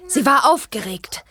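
lightly storybook reading voice; reading aloud. Acted. Bibi reading her pony book, light reading tone. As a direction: read the pony book to yourself, a touch dramatic.

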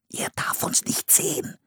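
put-on soft, urgent child's whisper. A narrator's reading. Boy warning his sister near the village, urgent whisper. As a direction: whisper a warning so nobody notices. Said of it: "flüsterte Philipp".

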